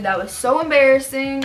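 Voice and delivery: ordinary delivery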